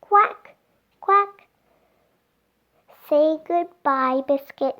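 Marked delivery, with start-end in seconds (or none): 0.0-1.5 high, loud little duck quack. Acted. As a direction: quack like a tiny duckling.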